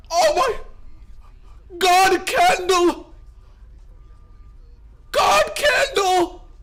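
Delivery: shrieking high with joy at the chart; screaming Oh my God, candle, voice cracking; ecstatic screaming. Real, not acted. Streamer screaming at chat over a green candle, ecstatic.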